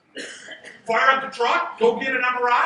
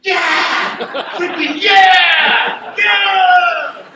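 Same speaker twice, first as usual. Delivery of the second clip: hammed-up shout of joy, much louder and higher; mock cheering; 6 semitones higher, 8 dB louder, much slower than usual. Mocking patients who cheer for orthodontists, loud fake joy. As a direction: cheer like a fan at a game for braces. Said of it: "No one's like".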